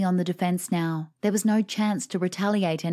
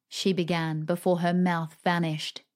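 ordinary delivery

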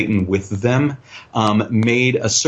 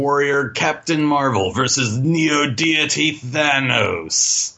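wrestling promo voice; 4 semitones higher than usual. Retold or imitated. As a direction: announce the fighters like ringside hype. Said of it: "like a wrestling promo".

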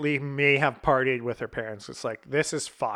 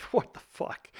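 whispered, stunned aside; retold whispered disbelief; 6 dB quieter than usual. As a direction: whisper it as if to yourself, baffled. Retold stunned reaction to time limit.

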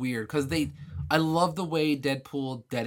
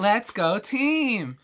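high, loud, drawn-out team chant; cheerleader cheer; 6 semitones higher, 5 dB louder, much slower than usual. Acted. Co-host mimics the cheerleader skin, peppy high cheer.

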